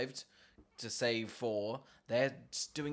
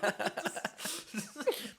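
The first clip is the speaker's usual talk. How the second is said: high laugh; 12 semitones higher than usual. Real, not acted. Laughing at counting joke.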